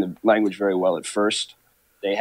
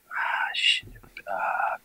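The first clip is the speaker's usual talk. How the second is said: whispering; 8 dB quieter, much slower than usual. Real, not acted. Recalling name, whispered.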